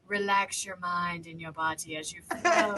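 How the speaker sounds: soft, slow, laughing spa voice; mock soothing massage voice. Mock relaxing a sleepy friend on FaceTime, soft and slow. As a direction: lull her like a massage tape, half joking. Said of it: "soothing things".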